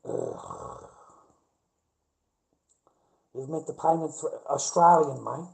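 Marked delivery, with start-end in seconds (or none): none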